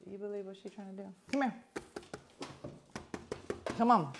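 lightly voiced pet, hushed and doubtful; pet's inner voice. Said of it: "She's like".

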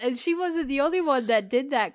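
ordinary delivery

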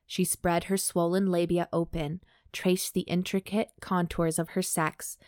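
measured, even audiobook read; composed narrator, pausing between phrases; story narration. A narrator's reading. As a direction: read the story aloud with clear, careful phrasing. Reads erotic fiction aloud.

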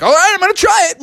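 high, hurried attempt; fast high cartoon voice; nervous Froggy. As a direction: rush it out while tumbling into motion. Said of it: "and Froggy was like".

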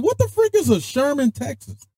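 very high, incredulous question; baffled outburst. Retold or imitated. A sergeant asks his soldier what Sherman, Texas even is, very high and baffled. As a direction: throw it up high, bewildered. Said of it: "I'm like".

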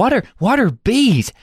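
high mock-baffled question; puzzled film character. Newcomer asking about Cockney slang, mock puzzled.

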